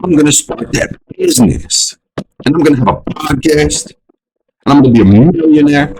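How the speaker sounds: high, giddy new year resolution voice; naive new year excitement. Retold or imitated. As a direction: gush like someone on January first. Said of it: "You're like".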